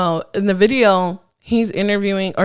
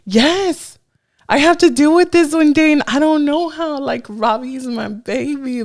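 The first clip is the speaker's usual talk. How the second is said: high-pitched, mock tearful wail; retold crying; 7 semitones higher than usual. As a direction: act out crying over your baby, pitched high. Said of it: "Like, crying hard".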